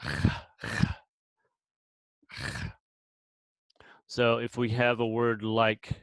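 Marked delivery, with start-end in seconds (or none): 0.0-2.6 isolated "ch" as a throaty friction sound, as in "Bach"; guttural ch. Shown as a demonstration. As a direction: rasp a bare "ch" from the throat, pausing between. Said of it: "is pronounced like ch".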